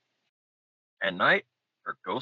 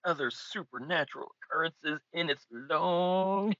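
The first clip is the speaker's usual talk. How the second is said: the word long stretched out slowly; drawn-out long; 8 semitones higher than usual. Shown as a demonstration. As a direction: drag out long. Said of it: "You see what I did there? Long".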